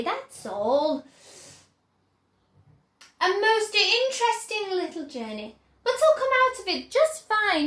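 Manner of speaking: breezy showman calming a mother; chocolate maker cheerfully calming a frantic mother; excited Wonka. A narrator's reading. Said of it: "cried Mister Wonka".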